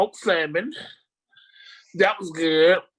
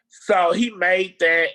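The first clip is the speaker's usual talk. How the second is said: usual speaking voice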